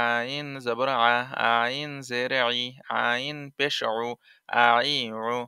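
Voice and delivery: slow, the ain pressed from mid-throat; throat-squeezed ain demo. Shown as a demonstration. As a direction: squeeze আইন from the middle of the throat, slowly. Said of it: "আইন হলকের মধ্য হতে গলা চিপে আদায় হবে". (গলা চিপে ধীরে আইনের উচ্চারণ)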